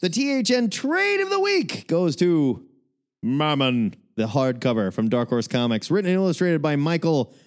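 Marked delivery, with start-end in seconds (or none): 0.0-3.9 big booming segment intro; announcer voice. Acted. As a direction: announce it like a game show host.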